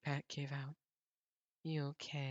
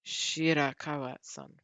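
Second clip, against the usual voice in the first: reading the line by belting out the name slowly; loud drawn-out name; 12 dB louder, slower than usual. Acted. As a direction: call the name out, loud and slow. Calling out a character name.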